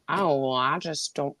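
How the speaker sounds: a soft, lazy mumble with the mouth barely open; mumbling imitation. Podcaster mocking a reality star's voice, soft mumble. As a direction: mimic a cast member who talks into her mouth.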